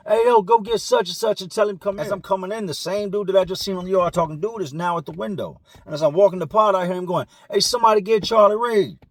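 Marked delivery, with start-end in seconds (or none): 0.1-2.1 window yell. Retold or imitated. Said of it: "dudes will come to that window and yell". 7.5-9.0 yelled through a window, higher and louder; calling out. Retold or imitated.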